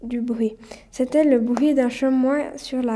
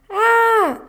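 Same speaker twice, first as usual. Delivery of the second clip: high, loud "aah" scream; narrated scream; 10 semitones higher, 7 dB louder than usual. A narrator's reading. Camille falls into a crevasse, screaming. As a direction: cry out in sudden alarm. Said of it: "hurla Camille".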